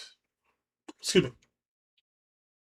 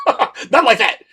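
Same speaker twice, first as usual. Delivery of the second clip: laughing shout; 8 semitones higher, 8 dB louder, slower than usual. Real, not acted. Joke about child support.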